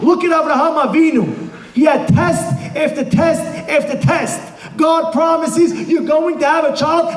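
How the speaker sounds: high, driving sermon delivery; impassioned preaching. Real, not acted. Preacher retelling Abraham's test to the congregation, impassioned preaching. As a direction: preach it urgently, pitched well up.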